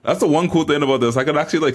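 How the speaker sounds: ordinary talk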